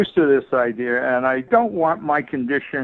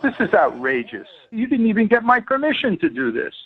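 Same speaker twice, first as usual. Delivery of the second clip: real indignation, pitch climbing as it goes; upset objection, rising higher on the second sentence; 6 semitones higher than usual. Real, not acted. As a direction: protest as an outraged patient. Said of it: "you sound upset".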